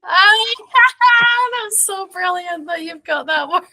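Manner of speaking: delighted laugh while talking; real laughter mid-sentence; laughing through words. Real, not acted. Delighted by the slang word.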